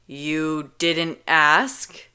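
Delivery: drawn-out disbelief; incredulous. Acted. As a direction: stare her down in disbelief. Kenji incredulous.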